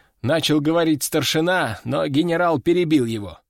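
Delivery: ordinary delivery, nothing marked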